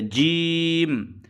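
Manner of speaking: জিম drawn out long for three alif counts; three-count stretch. Shown as a demonstration. Teacher stretching জিম for young students. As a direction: hold the vowel of জিম long and level. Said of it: "তিন রয়েছে".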